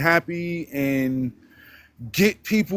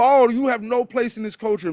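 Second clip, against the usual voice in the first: higher-pitched mimicry of critics; mock objectors; 8 semitones higher than usual. People telling him he has no place in the culture, mock objection. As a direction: imitate the naysayers in a raised pitch. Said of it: "like, people".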